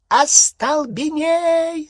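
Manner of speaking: very high, loud, drawn-out shriek; piercing battle cry; screeched spell. A narrator's reading. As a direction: scream the curse at full pitch. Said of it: "завизжала она".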